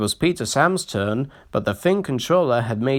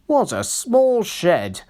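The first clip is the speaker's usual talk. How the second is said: grumbling; 8 semitones higher, slower than usual. A narrator's reading. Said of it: "grumbled Sir Handel".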